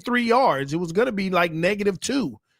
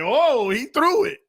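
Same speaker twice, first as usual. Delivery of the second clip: mock surprised linebacker; 7 semitones higher than usual. Fan voicing the linebacker seeing the throw, mock surprise. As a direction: lightly imitate a startled defender. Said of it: "The linebacker was like".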